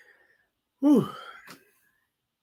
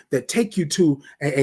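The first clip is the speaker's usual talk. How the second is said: normal talking voice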